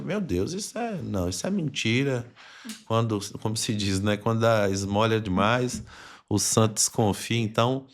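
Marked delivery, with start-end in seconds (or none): 0.0-2.3 imitating an amazed listener, pitch jumping higher; playing someone who can't believe the good news; mock disbelief. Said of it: "já". (descrença fingida, voz mais aguda)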